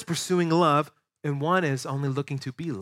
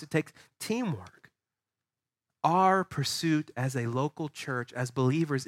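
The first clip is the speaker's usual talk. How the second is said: softer, stepped list of what it takes; quiet preaching; 5 dB quieter than usual. Acted. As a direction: lay each demand down gently.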